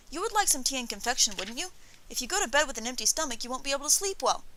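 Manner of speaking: put-on high, eager child's voice; coaxing boy. A narrator's reading. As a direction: coax the guest like a hopeful kid.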